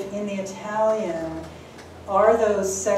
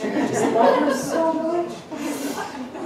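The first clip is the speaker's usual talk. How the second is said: mock gushing, much higher and very slow with a laugh; 9 semitones higher, 3 dB louder, much slower than usual. Shown as a demonstration. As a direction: stretch it out in exaggerated adoration, laughing. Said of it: "to say something other than".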